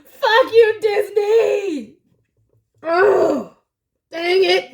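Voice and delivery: high, loud cry of exasperation; tearful outburst. Real, not acted. Reader blaming the film studio for the tears, crying shout. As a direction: curse it out half laughing, half crying. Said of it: "I'm sweating and crying".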